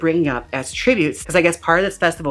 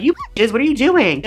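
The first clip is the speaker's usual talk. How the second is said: outraged; 9 semitones higher than usual. Retold or imitated. Danny outraged at mocking girls.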